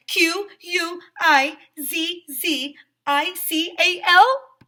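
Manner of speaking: high, loud, slow letter-by-letter shout. Acted. Lizzie waking up and shouting out her spelling word, loud and high. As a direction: bellow each letter as you jolt awake. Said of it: "She shouted".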